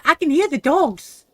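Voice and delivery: loud, high burst of excitement; excited exclamation. Real, not acted. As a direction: blurt it out, thrilled. Excited about hearing dogs.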